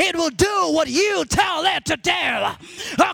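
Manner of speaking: high, driving sermon delivery; impassioned preacher rousing the church; full-force preaching. Real, not acted.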